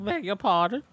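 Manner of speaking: high, prissy beg your pardon; mock-offended barmaid; prim mock politeness.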